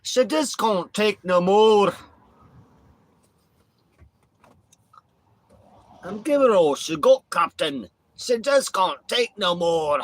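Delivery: loud, high, hammed-up Scottish cry; Scottish accent. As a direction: strain like a desperate Scottish engineer. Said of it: "I love Scottish accent".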